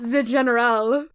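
put-on French-accented "the general", said "zee"; fake French accent. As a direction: relish it in a mock French accent. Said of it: "I love that z".

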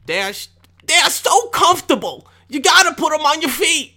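high, loud mock girlfriend voice; squeaky imitation of his girlfriend. Mimicking his girlfriend's Crocs pitch, high and loud. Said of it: "My girlfriend was telling me".

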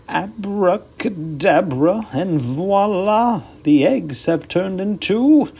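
slow, high showman's incantation; grand magician voice. Acted. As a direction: announce it like a stage magician.

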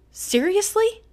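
high, rising disbelief; indignant, incredulous question. Real, not acted. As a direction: pitch it way up, appalled. Angry disbelief at the email.

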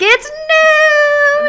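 high, loud mock cheer of a bus driver; driver's cheerful noon holler; bus driver yell. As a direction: belt it like an over-excited driver. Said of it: "bus driver starts yelling".

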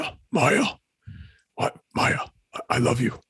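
dying crime boss pleading; gasping Kingpin begging Maya; Wilson Fisk impersonation. Pretend. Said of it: "Wilson Fisk impersonation".

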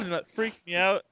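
normal talking voice

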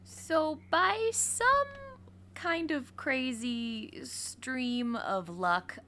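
slow, drawn-out dramatic build; dramatic slow narration. Acted. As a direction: stretch it out like a storyteller. Dramatic storytelling, slowed.

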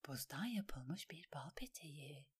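soft, low whisper; hushed thought voiced on the breath; inner thought. A narrator's reading. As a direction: whisper it as a private musing. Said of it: "diye düşündü Alain".

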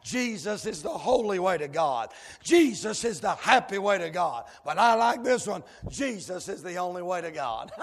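usual speaking voice

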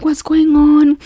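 high-pitched mock-panicked questioning. Policeman reacting to her roadside tears, mock panic. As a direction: imitate a worried man, pitched well up. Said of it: "he was panicking".

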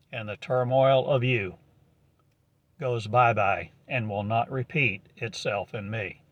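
ordinary delivery

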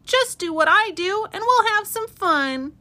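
"Just do what I do" chirped high like a squirrel; cheeky squirrel. A narrator's reading. Squirrel dares the rabbits to copy him, high and cheeky. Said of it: "cried a squirrel".

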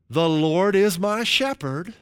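preacher leading a repeat-after-me; call-and-response. Shown as a demonstration. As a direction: lead the congregation line by line. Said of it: "Just repeat after me".

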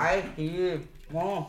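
talking with a mouthful; words around a bite of food; mouth full. Real, not acted. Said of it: "reden mit vollem Mund".

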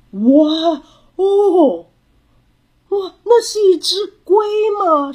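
high, loud wows of wonder; amazed excitement. A narrator's reading. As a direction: exclaim like an astonished child. Child spots a turtle, amazed. (又高又响地惊叹)

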